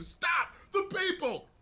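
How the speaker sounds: high shouted hero line; hammed-up Superman cry; mock hero shout. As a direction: yell it like a cartoon hero. Voicing Superman shouting in film.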